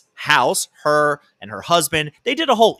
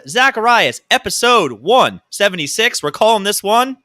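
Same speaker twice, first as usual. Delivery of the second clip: announcer voice; 7 semitones higher, 5 dB louder than usual. Pretend. Show intro announcer voice.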